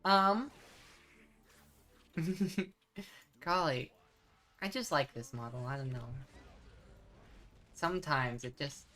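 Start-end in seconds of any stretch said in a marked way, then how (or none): none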